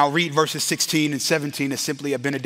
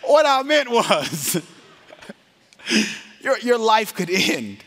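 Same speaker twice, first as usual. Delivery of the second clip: high, drawn-out words broken by laughs; laughing explanation; 7 semitones higher, slower than usual. Real, not acted. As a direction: struggle to explain without cracking up. Laughing while trying to rephrase.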